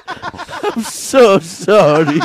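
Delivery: loud, high, very drawn-out cry; hammed-up wail, stretched out; mock wailed apology. Embarrassed caller apologising to operator, mock wail.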